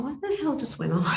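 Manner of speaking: high, puzzled inner voice; baffled. Retold or imitated. Herself after sounding, baffled and high. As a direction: wonder what just happened.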